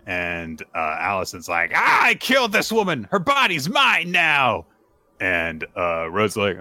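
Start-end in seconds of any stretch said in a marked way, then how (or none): 1.7-4.7 high, loud possessed gloating; hammed-up villain. Lich taunting the fiance, hammed-up high villain voice. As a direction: stretch out the ending like a cartoon villain.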